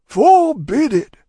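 loud, high, drawn-out proclamation; solemn and slow. A narrator's reading. Cruncher holding forth to Miss Pross, very solemn. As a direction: boom it out slowly like a preacher. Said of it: "with additional solemnity, additional slowness".

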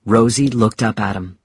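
ordinary delivery

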